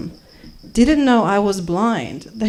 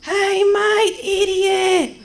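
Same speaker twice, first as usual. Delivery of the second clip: acted abusive yell; 12 semitones higher, slower than usual. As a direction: yell it like an angry passer-by. Acted abuse yelled at blind narrator.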